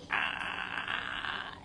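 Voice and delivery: low creaky groan of annoyance; throaty rasp of frustration; exasperated groan. Retold or imitated. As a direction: let out a long, creaky, fed-up groan. Venting past annoyance at chaos.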